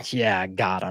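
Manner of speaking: low, soft mock gloat; quietly gloating dad. Dad watching his son open the box, quietly gloating. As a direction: lightly play a dad pleased with his trick.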